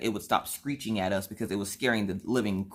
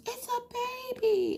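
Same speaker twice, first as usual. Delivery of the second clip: put-on much higher, a small girl's excited voice; high little girl voice; slower than usual. Daughter spotting a prairie dog, high little girl voice.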